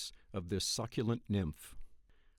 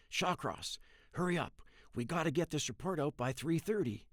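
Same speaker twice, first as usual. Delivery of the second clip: higher, rushed urgent boss; frantic boss; 4 semitones higher than usual. A narrator's reading. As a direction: hurry him along in a fluster.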